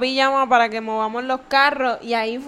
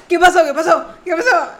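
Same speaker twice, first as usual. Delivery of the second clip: startled high "¿Qué pasó?" louder and higher; alarmed mom; 4 semitones higher, 9 dB louder than usual. Retold or imitated. Sibling retelling how mom woke up, alarmed and loud. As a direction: mimic a jolted-awake mother.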